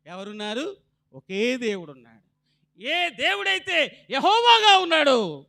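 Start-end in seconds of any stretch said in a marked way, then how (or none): 2.8-5.5 high, loud proclaiming, slowing down; rising sermon climax; climactic preaching. Acted.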